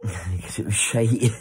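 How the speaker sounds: laughing, slow and loud. Real, not acted. Teasing the author about his book, laughing loud. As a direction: blurt it through laughter.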